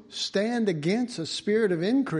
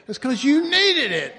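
louder, higher punchline; loud teasing punchline; 6 semitones higher, 6 dB louder than usual. Real, not acted. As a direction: land the joke sharply.

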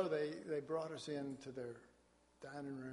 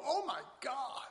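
high, amazed gasp of delight; mock wonder at the taste; amazed exclamation; 9 semitones higher than usual. A singer tasting the asparagus, high and amazed. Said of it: "and they go".

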